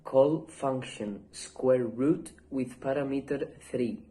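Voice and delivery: ordinary talk, nothing marked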